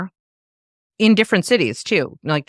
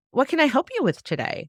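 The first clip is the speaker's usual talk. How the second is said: high, chirpy clerk question; trained retail greeting; 9 semitones higher than usual. Retold or imitated. A retail clerk to a shopper, in a chirpy trained voice. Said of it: "we were trained to be like".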